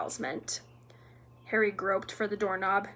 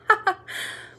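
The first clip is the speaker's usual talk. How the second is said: high, breaking cackle; gleeful cackle; 10 semitones higher, 5 dB louder than usual. A narrator's reading. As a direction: burst into mocking laughter. Peeves laughs at Filch.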